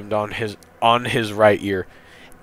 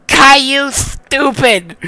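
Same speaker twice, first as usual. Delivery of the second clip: high, louder taunting little sister; Rosie's voice; 7 dB louder, slower than usual. A narrator's reading. Sister insulting her crying brother, high mocking shout. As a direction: call out the insult in a high-pitched voice. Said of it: "Rosie says".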